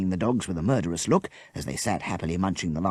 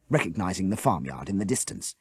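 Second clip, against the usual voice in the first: ordinary delivery, nothing marked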